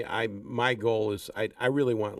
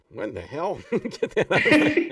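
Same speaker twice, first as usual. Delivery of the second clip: laughing; 7 semitones higher, 6 dB louder than usual. Retold or imitated. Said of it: "you're going".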